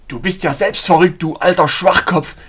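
loud, heated insult; angry scolding. A narrator's reading. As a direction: hurl abuse while shaking him. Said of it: "schimpfte ich".

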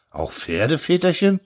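subdued, worried question rising at the end; anxious. A narrator's reading. Peasants asking the orator about horses, anxious. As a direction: ask timidly, dreading the answer. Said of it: "fragten ängstlich die Bauern".

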